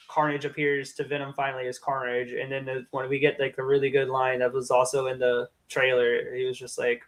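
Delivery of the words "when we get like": normal talking voice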